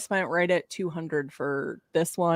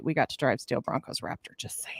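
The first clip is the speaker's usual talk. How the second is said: quick teasing whisper; 9 semitones lower, 8 dB quieter, faster than usual. Real, not acted. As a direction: whisper it as a playful aside. Teasing aside about driving.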